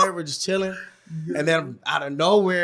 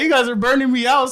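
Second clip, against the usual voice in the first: mock whine; 7 semitones higher, 5 dB louder than usual. Homie scolding them again.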